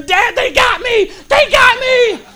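high, loud, drawn-out scream; screaming for dad. Retold or imitated. Preacher reenacting his childhood cry to dad, screaming. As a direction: scream it out like a terrified kid. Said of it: "And then I start screaming".

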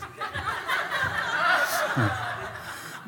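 long high-pitched laugh. Real, not acted. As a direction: burst out laughing at himself. Laughing at the tiny mule.

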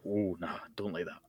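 hushed, low mock disapproval; disapproving crowd. Scottish audience reacting to a bad accent, hushed disapproval. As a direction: drop right down, as if wincing under your breath. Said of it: "you just hear the collective".

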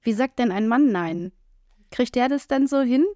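normal talking voice

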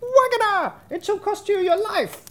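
very high-pitched bird cry; crying sparrow. A narrator's reading. Sparrow at the window threatening wagoner, very high cry. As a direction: call out the threat in piercing falsetto. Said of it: "in front of the window and cried".

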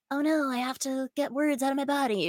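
high-pitched mock panic; mock dismay. Podcaster imitating her own pressured self, mock dismay. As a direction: play up the dread in a high voice. Mimics feeling pressured to write.

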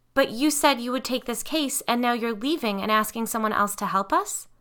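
mother protesting they are leaving, frustrated. A narrator's reading. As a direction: push back on their broken promise. Mother arguing with investigators.

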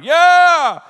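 high, loud victory squeal; a sudden shrill cheer over a tiny tomato; triumphant yelp. Retold or imitated. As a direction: cheer like you just won.

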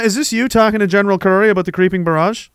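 ordinary talk

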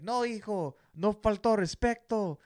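high, loud pleading in Spanish; remonstrating father. Retold or imitated. Dad telling son no disrespect happened, urgent pleading. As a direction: imitate a dad urgently correcting his son. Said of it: "going in on his son".

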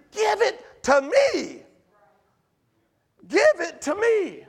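slow and drawn out, tender; God pleading. Acted. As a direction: ask for the burden gently. God's voice, pleading.

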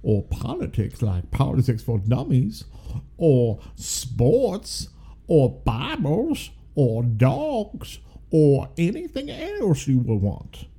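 a lightly hammed Southern lawyer pitch. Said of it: "You gotta say it like you're a Southern lawyer".

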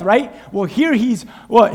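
ordinary talk

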